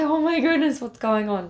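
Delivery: high, startled cry of surprise; sudden high alarm at being pulled along; startled gasp. Retold or imitated. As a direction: blurt it out as he suddenly grabs you. Stranger drags her across street.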